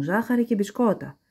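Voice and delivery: ordinary delivery, nothing marked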